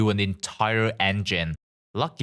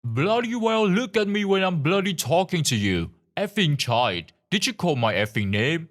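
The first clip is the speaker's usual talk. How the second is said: angry shout; 9 semitones higher than usual. A narrator's reading. Angry man shouts at the narrator, a narrated furious shout. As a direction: yell it at someone you mistake for staff. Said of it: "starting to shout".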